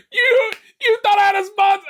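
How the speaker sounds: high, loud, cracking up; can barely get it out for laughing; teasing listeners about a sponsor, laughing hard. Real, not acted. Laughing at his own joke.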